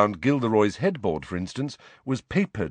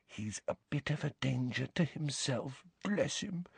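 hushed, confiding whisper; 4 semitones higher, 12 dB quieter than usual. Acted. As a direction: drop to a whisper behind his back. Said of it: "she lowered her voice to a whisper".

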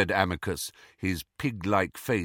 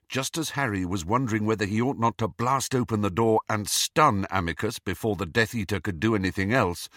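usual speaking voice

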